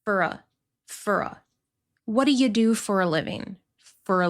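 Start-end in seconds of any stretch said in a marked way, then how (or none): 0.0-1.4 "fura" as one quick syllable pair; reduced for a. Shown as a demonstration. Teacher demonstrating reduced "fura". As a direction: blend "for a" into "fura". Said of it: "are also reduced to".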